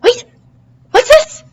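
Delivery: high, loud surprise; surprised. Acted. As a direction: gasp it out. Sudden surprise.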